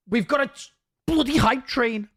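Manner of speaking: bursting with hype, high and loud; hoarse sleep deprived hype. Real, not acted. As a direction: yell it out, hyped up. Hype train arrives, streamer erupts.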